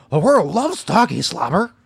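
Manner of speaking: lightly voiced silly dog; high playful dog character. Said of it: "I was thinking, like".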